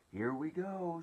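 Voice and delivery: high, loud whoop of excitement; excited shout. A narrator's reading. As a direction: call out as if hopping with excitement. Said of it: "shouted the old green grasshopper".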